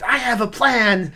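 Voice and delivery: loud, high imitated shout; Pat Healy shouting. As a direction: shout it like a big movie line. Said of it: "for him to shout after the fact".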